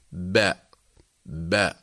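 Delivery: low "باء" as an open "ba", b released into the vowel; voweled consonant. Shown as a demonstration. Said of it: "الحرف المتحرك فيخرج بالتباعد". (نطق "باء" مفتوحة بتباعد الشفتين)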